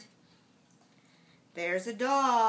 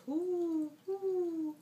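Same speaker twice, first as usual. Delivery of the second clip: owl hoot; 6 semitones higher than usual. Acted. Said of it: "Ça va says".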